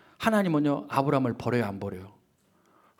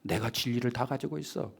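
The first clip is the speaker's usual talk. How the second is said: mock smug voice, softer; dismissive believer; 4 semitones lower, 8 dB quieter than usual. Preacher mimicking a self-reliant believer, softly smug. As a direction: brush it off like someone who trusts only himself. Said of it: "옆에서 사람들이".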